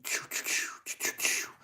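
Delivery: whispered whooshing magic sparkles; hushed imitation of flying magic; magic sound effect. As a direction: puff out quick unvoiced little zaps.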